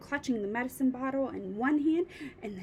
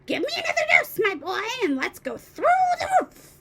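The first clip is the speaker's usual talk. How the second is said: put-on screechy old voice; 9 semitones higher, 7 dB louder than usual. A narrator's reading. Grandma shouting down from the attic, screechy. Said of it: "old screechy voice".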